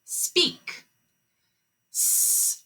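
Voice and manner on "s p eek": S-p-eek drawn out, much higher and softer; high soft segmenting; sounds stretched apart. Shown as a demonstration. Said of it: "Say it".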